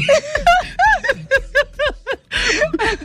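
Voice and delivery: long, high-pitched laughing fit; helpless laughter. Real, not acted. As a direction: keep cackling high and long. Everyone laughs at the attempt.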